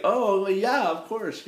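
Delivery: imitating his own reaction, pitched high; mock-casual agreement, starting high and loud; high-voiced reply. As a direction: retell it with the voice jumping way up. Acts out own reply to fan.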